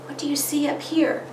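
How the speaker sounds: put-on prompting a child. Shown as a demonstration. Parent asking a child about the picture, inviting. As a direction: gently invite a little one to look. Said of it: "and start with".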